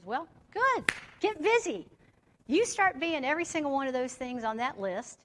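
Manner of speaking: high, loud emphatic telling-off; sponsor's firm order. Retold or imitated. Sponsor's stern retort, acted.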